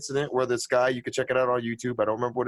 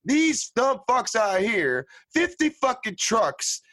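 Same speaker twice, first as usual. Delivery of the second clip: slow emphatic rant; 8 semitones higher than usual. Real, not acted. Ranting about hunters.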